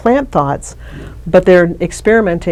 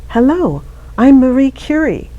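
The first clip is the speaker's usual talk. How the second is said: lightly voiced, higher-pitched woman with an accent; a hint of an accented female spirit, pitched up; Marie Curie; 8 semitones higher than usual. Retelling the voice heard outside her ear, lightly imitated accented woman. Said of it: "a female voice with a strong accent".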